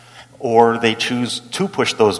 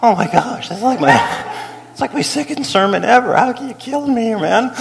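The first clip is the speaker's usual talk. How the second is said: rising higher mock despair; exasperated plea; 8 semitones higher than usual. Young pastor lamenting his second sermon, mock despair. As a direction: whine it to the heavens. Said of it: "And I'm like".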